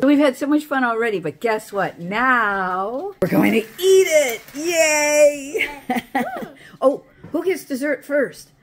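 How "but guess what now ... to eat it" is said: ordinary delivery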